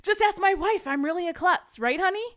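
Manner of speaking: loud pleading appeal. Retold or imitated.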